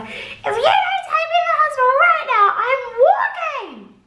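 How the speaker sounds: high, loud shouted ultimatum; screaming threat. Retold or imitated. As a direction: scream it at the family in the car.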